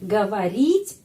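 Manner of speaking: usual speaking voice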